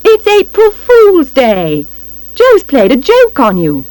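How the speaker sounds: high, triumphant call; high, drawn-out announcement. A narrator's reading. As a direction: call the joke out to the crowd. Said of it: "Monica calls".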